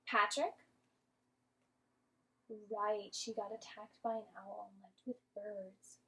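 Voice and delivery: ordinary delivery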